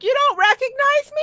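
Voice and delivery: hammed-up high girly voice for the friend in a dress; high falsetto imitation of a drag friend; high mock feminine voice.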